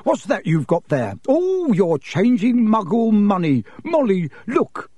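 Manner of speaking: rising into high, eager wonder; marvel, then call her over excitedly; delighted. A narrator's reading. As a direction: gush with growing excitement. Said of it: "said Mister Weasley delightedly".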